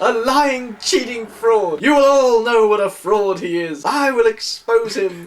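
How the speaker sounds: high, loud, slowly spat accusations; furious, drawn-out denunciation; loud tirade. Acted. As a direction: keep shouting her threats. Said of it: "she continued".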